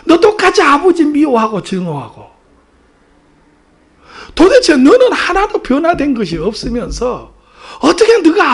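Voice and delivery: loud, high, relentless scolding; thunderous rebuke. Retold or imitated. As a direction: thunder down at a guilty child. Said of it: "벼락과 같은 소리로 호통을 치셨어요". (벼락같이 호통치듯)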